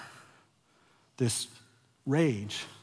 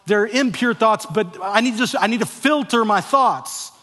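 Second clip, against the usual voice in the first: higher, louder rationalizing inner voice; anxious self-justifying; 8 semitones higher, 6 dB louder than usual. Retold or imitated. Churchgoer reasoning about impure thoughts, anxious self-justifying. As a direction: talk yourself into filtering, anxious. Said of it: "we think".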